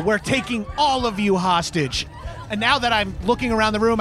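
usual speaking voice

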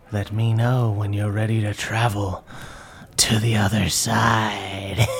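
low creepy voice, slowing at the end; Zelda. Acted. Zelda offers passage to the party, creepy low voice. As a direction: draw out the last words eerily, low. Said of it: "creepily looks at you guys and goes".

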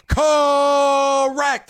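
loud, high mock shout of Correct; Miz catchphrase shout. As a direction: belt out Correct as a hammed-up Miz.

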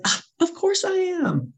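very high, slow, drawn-out agreement; mock eager assurance. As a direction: squeak out an over-keen yes. Overeager reply to being screamed at.

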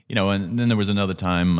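usual speaking voice

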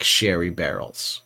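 normal talking voice

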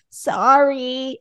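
put-on stretched-out, insincere child's sorry; unrepentant apology. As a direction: imitate a kid's grudging second apology. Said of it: "that's not a repentant apology".

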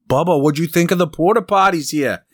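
high, loud teasing heckle; hammed-up heckler calling out to Bubba; mock heckler. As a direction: yell it from the gallery to needle the golfer. Heckling Bubba at golf tour.

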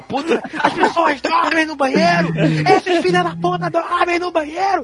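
shouting high through laughter, outraged; laughing shout. Retold or imitated. Retelling the hotel room, yelling through laughter at his wife. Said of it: "Cala a boca, para de gritar".

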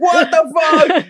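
high, slow mock-terrified wail; panicked chicken. Comic voicing a chicken stuck in a speeding engine, mock panic. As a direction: play a chicken screaming in comic horror. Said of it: "this chicken with his wings spread going".

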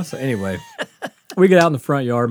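ordinary talk

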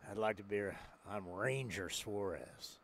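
low menacing gunslinger. Pretend. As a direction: growl it like an old Western gunfighter. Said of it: "I'm like an old Western guy".

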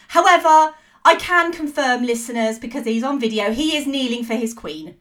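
ordinary talk, nothing marked

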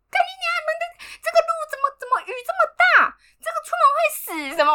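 high, fast, angry cursing at the road; cursing rant. Retold or imitated. Rider cursing the storm on her scooter, fast high angry rant. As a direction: swear at the storm while riding. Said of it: "我就边骑边骂".

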